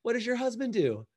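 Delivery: high, sugary put-down; mock condescension. Mimics people belittling a salon owner.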